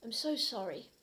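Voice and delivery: ordinary talk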